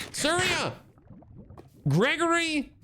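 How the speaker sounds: high call to teammates. Real, not acted. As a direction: shout names across. Calling out teammates' names.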